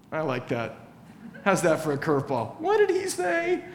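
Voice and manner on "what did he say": high, puzzled question as a confused listener; mock puzzled. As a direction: play the baffled hearer, pitched way up.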